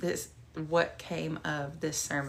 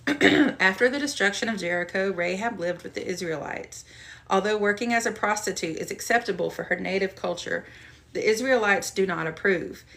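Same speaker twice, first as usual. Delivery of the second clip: louder study-note reading; reading aloud; 6 dB louder than usual. Shown as a demonstration. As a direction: read the note out steadily to listeners. Reads study notes aloud.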